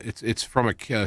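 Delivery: ordinary talk, nothing marked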